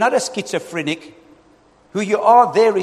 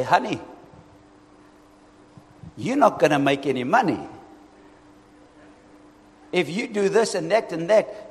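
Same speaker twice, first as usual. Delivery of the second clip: gentle father; 3 dB quieter than usual. Retold or imitated. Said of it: "I take her aside and I say".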